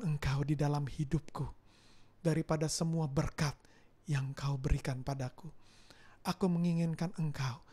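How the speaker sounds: low, soft, unhurried pastoral appeal; tender preaching. Acted. Preacher applying the story to the congregation, soft tender preaching. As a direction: speak gently to the congregation, drawing them in.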